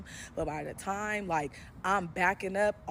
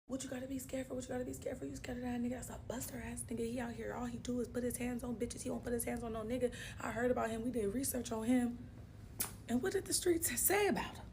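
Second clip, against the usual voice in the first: scolding cousins; 4 semitones higher, 6 dB quieter than usual. Retold or imitated. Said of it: "My brother and my cousin like".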